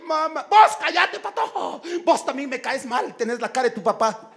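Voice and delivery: high, loud opening easing into taunting; acted family squabble. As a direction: snap back in a hammed-up family quarrel. Acted mother-child shouting match. (pelea familiar actuada, voz aguda)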